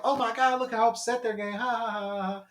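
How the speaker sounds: high, loud gloating mock laugh; mocking troll. Retelling a chat troll, loud mocking cackle. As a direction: sneer like a gleeful troll.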